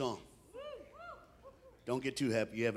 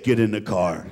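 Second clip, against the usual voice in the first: deep, loud fatherly order; stern angry dad; 13 semitones lower, 5 dB louder than usual. Retold or imitated. Dad ordering his son to the car, deep and stern. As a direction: lay down the order, very low.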